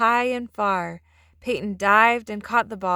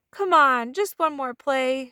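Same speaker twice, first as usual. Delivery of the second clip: put-on pleading boy, higher and louder; little brother pleading to keep playing; mild shout; 6 semitones higher, 4 dB louder, slower than usual. A narrator's reading. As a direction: beg for one more play, slowly. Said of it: "shouted Eli".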